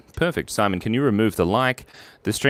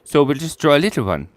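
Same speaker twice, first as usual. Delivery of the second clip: ordinary delivery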